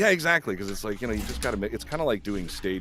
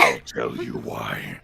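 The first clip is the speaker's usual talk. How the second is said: low, slow demon voice; demonic voice; 9 semitones lower, 5 dB louder, much slower than usual. Acted. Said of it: "this mean demonic vent".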